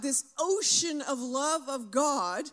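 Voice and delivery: normal talking voice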